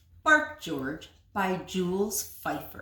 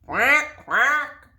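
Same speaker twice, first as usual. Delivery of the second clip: slow, loud quacking; duck quack; 8 semitones higher, 10 dB louder than usual. Acted. Puppy quacks at his mother, loud and slow.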